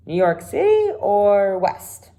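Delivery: uninterested, barely looking up; bored Tom. Acted. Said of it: "hardly looked at him".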